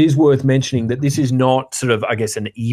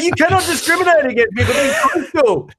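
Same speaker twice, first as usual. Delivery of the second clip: mock outrage. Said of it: "I'm like".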